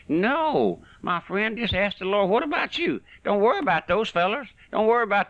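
high-pitched, insistent preaching; urgent exhortation. Real, not acted. Preacher turning the objection back on the listener, urgent exhortation. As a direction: drive it up to a high, pointed question.

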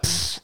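soft scornful puff of air; dismissive pfft. Retold or imitated. Barfly mocking a missed pool shot, dismissive pfft. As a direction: blow a quick mock raspberry. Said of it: "he was just like".

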